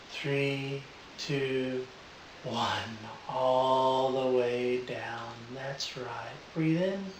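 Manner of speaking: soft hypnotic deepening; calm, slow trance voice. Real, not acted. Live induction deepening.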